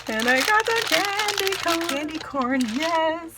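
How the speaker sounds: high-pitched, drawn-out delight; real squealing glee over a present; excited squeal. Real, not acted. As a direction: gush in a high, thrilled voice. Delighted thanks while unboxing a gift.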